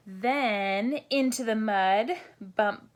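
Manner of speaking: plain reading voice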